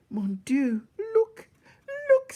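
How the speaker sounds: high, loud French mouse crying out; excited Antoinette. A narrator's reading. Mouse spotting Despereaux at the feast, high amazed cry. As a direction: gasp it out in wonder, voice soaring.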